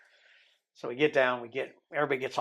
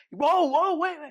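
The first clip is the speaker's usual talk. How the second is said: much higher, louder startled cry; startled whoa; 4 dB louder than usual. Retold or imitated. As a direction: stop him mid-undress in a high rush. Guard halts his undressing abruptly.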